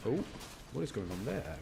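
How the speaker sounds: usual speaking voice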